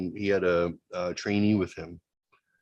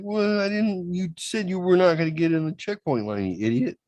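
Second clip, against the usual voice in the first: high, mock-scolding girlfriend voice; 9 semitones higher than usual. Scolding her boyfriend for missing the turn, high and nagging. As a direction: nag him like a fed-up partner. Wife scolding him in car.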